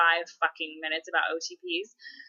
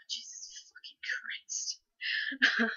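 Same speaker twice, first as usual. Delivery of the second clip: hushed exasperated whisper; 8 semitones higher, 6 dB quieter than usual. Real, not acted. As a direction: breathe it out in disbelief. Overwhelmed by choosing ships.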